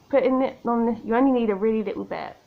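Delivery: normal talking voice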